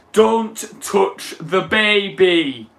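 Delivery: dramatic quote. Retold or imitated. Quoting iconic Doctor Who line.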